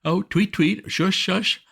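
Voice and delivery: mock a butler calming an earthquake; mocking Jeeves soothing. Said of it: "lifted up your finger and said".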